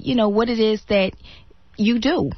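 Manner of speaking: normal talking voice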